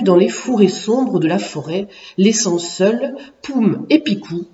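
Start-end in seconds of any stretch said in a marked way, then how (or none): none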